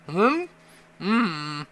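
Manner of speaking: high questioning hmm then flat mm; puzzled hum. Retold or imitated. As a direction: hum as if not understanding. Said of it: "She's like".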